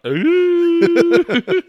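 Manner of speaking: long, high, loud "oooh"; mock wincing high hoot; drawn-out high squirm noise; awkward "ooh". His awkward reaction to manager's outburst.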